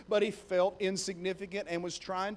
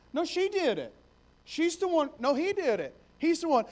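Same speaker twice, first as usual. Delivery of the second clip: put-on tattling kids; 7 semitones higher than usual. Kids blaming each other at school.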